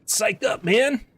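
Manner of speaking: hyped. Real, not acted. Streamer hyping himself up for war, high and excited. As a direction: pump yourself up, way up high.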